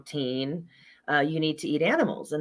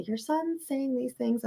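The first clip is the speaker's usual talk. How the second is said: a hint of a fussy teacher, higher, softer and slow; teacher's voice; 9 semitones higher, 5 dB quieter, slower than usual. Teacher reporting the son to mom, higher, soft and slow. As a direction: lightly mimic the complaining teacher, pitched up. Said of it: "the teacher would talk to me and say".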